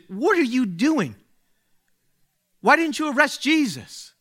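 high, exasperated demand; indignant Pharisees. Retold or imitated. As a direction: scold the guards like offended priests.

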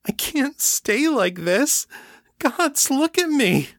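a light tearful quaver from Kate; thick with tears. A narrator's reading. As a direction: speak through held-back tears. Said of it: "her voice thick with tears".

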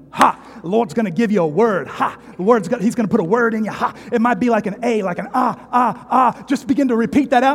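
high, loud Pentecostal preacher voice; hammed-up holy-ghost evangelist; fervent evangelist. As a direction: ham up a fired-up evangelist. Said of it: "he gets the Church of God on him".

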